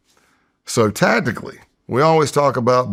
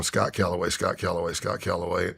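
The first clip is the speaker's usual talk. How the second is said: muttering to self; 4 semitones lower, 9 dB quieter than usual. Retold or imitated. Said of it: "I'm going".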